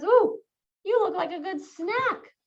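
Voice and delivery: hungry lion. Pretend. Lion eyeing the little mouse as a snack, mock hungry. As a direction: tease the mouse as a tasty snack. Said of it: "the lion says".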